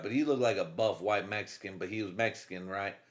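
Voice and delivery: usual speaking voice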